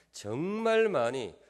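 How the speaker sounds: 정말 very slow, stretched and loud; stress on 정말. Real, not acted. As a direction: swell on a long, drawn-out 정말. Stretches word before dialect versions. (정말을 아주 길고 크게 강조)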